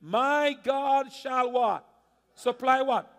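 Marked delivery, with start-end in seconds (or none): 0.0-1.5 slow drawn-out preacher call, waiting for the crowd to finish; slow prompting call. Real, not acted. Pastor slowly prompts his congregation to finish the verse, drawn-out call. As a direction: stretch it out and leave it hanging for the church.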